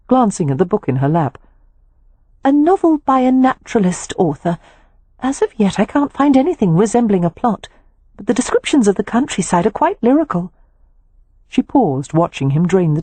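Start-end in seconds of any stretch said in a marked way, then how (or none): 2.4-10.5 Poppy's voice. A narrator's reading.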